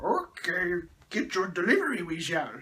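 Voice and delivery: croaky creature caricature; Yoda. Retold or imitated. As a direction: put on a croaking sage voice. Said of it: "And Yoda's like".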